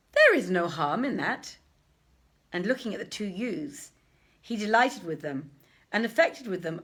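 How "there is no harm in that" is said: lightly voiced grave overseer, louder; a touch of the old syndic's gravity; hint of a dignified old man. Overseer answers.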